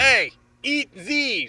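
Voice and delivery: slow, loud, high mocking jeer; defiant taunt. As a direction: flip them off with your voice. Taunting the camera.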